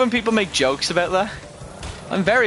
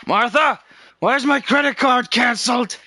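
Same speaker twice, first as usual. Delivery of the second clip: put-on old man voice; 8 semitones higher, 3 dB louder than usual. Old man yelling to wife.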